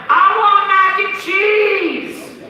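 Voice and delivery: put-on high, slow whining kid voice; whiny little daughter. Preacher imitating his little daughter, high slow whine. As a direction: play a toddler begging for mac and cheese. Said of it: "my daughter's like".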